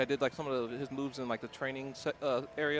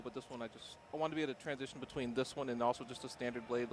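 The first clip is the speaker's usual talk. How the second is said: normal talking voice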